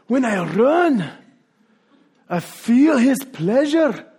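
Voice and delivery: slow, lifted Scottish brogue for the quote; imitating a Scottish runner, high and slow; Scottish accent. Preacher quoting the runner in a Scottish accent. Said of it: "I'm gonna use the accent anyways".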